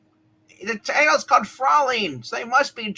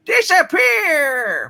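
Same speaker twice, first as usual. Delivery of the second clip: dramatic drawn-out; 6 semitones higher, 7 dB louder, slower than usual. Acted. Magic announcement.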